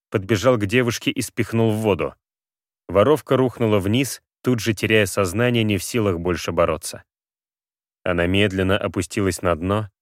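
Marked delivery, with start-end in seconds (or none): none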